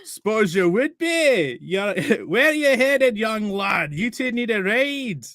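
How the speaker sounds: put-on high, slow friendly Scottish accent. Caravan man offering the boys a ride, slow Scottish accent. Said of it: "gotta go for Scottish".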